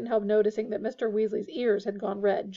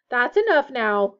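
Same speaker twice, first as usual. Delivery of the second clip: louder, slow motherly warning; firm telling-off; 7 dB louder, slower than usual. A narrator's reading. Missus Weasley stops the twins' joking, firm. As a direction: rein them in firmly.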